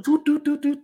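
mimicked trot noise. Imitating dog's trotting sound.

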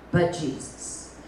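slow hushed whisper; reverent whisper. Real, not acted. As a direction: breathe the name softly.

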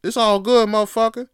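big up your friend like a hype man; hyped-up reassurance. Retold or imitated. Friend cheering you up, hyped loud reassurance. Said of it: "a friend out there that's like".

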